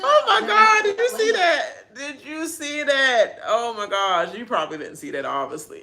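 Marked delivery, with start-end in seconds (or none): 0.0-3.4 loud, high, laughing, slowing down; can't believe it, laughing while shouting; laughing disbelief. Real, not acted. Vlogger reacts to Taylor's clip, laughing loud excitement.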